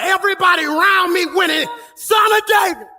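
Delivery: high, loud preacher's shout of the name; shouted cry. Acted. Preacher crying out Son of David to the congregation, full loud shout. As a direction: cry it out like a desperate plea.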